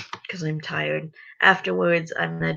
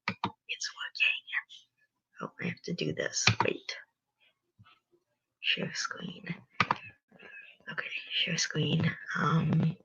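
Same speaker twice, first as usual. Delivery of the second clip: whispered under the breath; whispering; 13 dB quieter than usual. Real, not acted. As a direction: mutter to yourself in a whisper. Muttering setup to herself.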